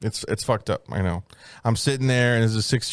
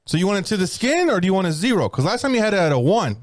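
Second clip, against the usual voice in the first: Vietnamese accent attempt; 7 semitones higher, faster than usual. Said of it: "I can't do the accent".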